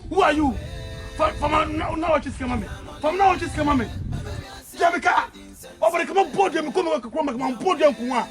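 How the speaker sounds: loud, high, raging rant; furious tirade; furious shouting. Acted. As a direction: scream at him, out of control. Continues the furious outburst.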